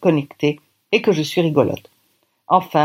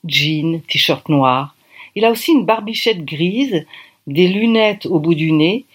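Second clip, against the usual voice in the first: plain reading voice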